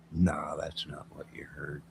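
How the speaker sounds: unvoiced hushed denial; secretive whispered denial. Real, not acted. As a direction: whisper it so nobody else hears.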